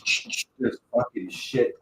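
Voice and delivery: ordinary delivery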